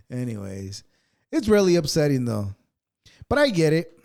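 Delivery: ordinary delivery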